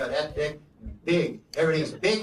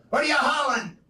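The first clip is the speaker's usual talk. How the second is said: high, loud trucker holler; hammed-up trucker shout; trucker talk; 8 semitones higher, 4 dB louder than usual. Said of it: "talk to you like a trucker".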